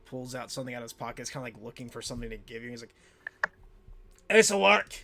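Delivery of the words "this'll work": high, very loud blurt; panicking dwarf. Acted. Panicking dwarf finding something to give, high shout. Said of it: "one of those panicking about the dr-, dragon".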